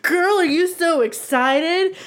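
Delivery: high, slow, sing-song gushing; mock sassy girl. Imitating the guy prying about her date. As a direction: stretch GIRL high and gushy. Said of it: "And I was like".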